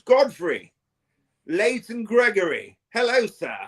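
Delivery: high, drawn-out posh voice; mock posh. Streamer greeting viewers with posh names, mock posh. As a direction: greet them as if they were gentry. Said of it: "Look at all these posh names".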